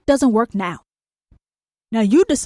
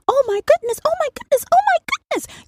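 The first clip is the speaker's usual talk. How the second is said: rising higher and higher, mock alarm; mock shocked gasping; faster than usual. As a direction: parody someone clutching their pearls. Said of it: "You're saying".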